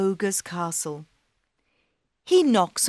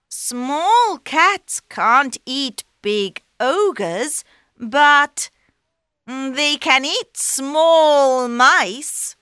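sly cat; 7 semitones higher, 7 dB louder, much slower than usual. Acted.

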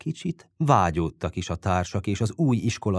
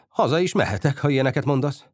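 hurt retort in a raised pitch; offended; 6 semitones higher, 3 dB louder, faster than usual. A narrator's reading. Touchy friend answering the sick boy's reproach, offended. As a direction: take instant offence, voice up. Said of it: "Bütyök azonnal megsértődött".

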